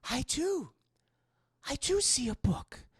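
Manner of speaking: high, airy put-on woman's voice; entranced Catherine. Catherine answers the unseen voice, high and entranced.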